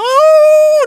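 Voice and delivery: sudden high, loud mock wail of grief. Preacher imitating a mourner's high, loud wail. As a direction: cry out like a hired mourner. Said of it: "nangis gitu ya".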